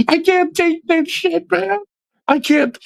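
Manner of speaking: high-pitched wail through laughter; comic, squealing protest; laughing despair. Real, not acted. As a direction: plead helplessly while cracking up. Mock despair at being deprived, laughing.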